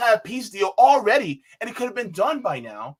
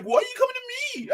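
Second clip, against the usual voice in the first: petulant whine. Retold or imitated. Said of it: "And you're like".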